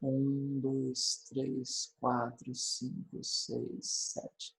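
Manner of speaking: very soft, low, half-whispered count; counting under breath. Real, not acted. Teacher checking if it has seven syllables, counting under breath. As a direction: count to yourself, fading almost to a whisper.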